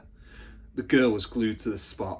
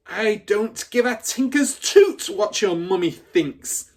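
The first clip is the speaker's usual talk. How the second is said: much higher and louder, slow; yelling; 10 semitones higher, 8 dB louder, slower than usual. Acted. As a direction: yell it down at the child. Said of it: "the Trunchbull yelled".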